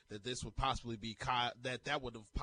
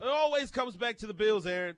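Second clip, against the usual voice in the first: shouted back, much higher; loud mock echo; 10 semitones higher, 11 dB louder than usual. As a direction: yell the line back teasingly. Echoes it loud and high.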